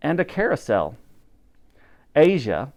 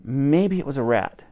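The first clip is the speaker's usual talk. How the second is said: plain reading voice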